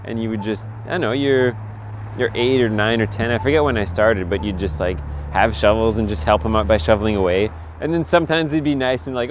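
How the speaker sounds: normal talking voice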